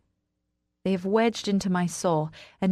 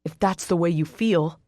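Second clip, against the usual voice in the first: restrained bark from the man; 3 dB louder than usual. A narrator's reading. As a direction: bite back at her, not shouting. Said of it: "he barks".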